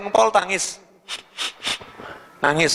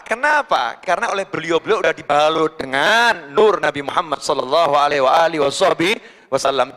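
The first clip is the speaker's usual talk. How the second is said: ordinary delivery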